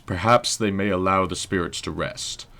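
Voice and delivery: stoic historian slipping into British; semi-British game character voice, not full British; drifting British accent. Acted. Historian explaining tortured spirits, stoic with drifting British accent. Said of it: "my voices drift in and out of Britishness".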